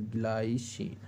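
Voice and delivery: ग्लाइसिन said "glai-sheen" while spelling it out; English pronunciation. Shown as a demonstration. Teacher writing the name for students, ग्लाइसिन with an English "glai". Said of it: "तो इसको ऐसा लिखते हैं".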